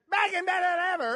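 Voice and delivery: high hammed-up announcer. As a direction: belt it like a TV promo. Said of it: "all of a sudden they heard".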